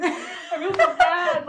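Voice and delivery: slow, high, loud laughing wail; laughing misery. Retold or imitated. Recalling crawling up the stairs, wailing through laughter. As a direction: laugh through the self-pity.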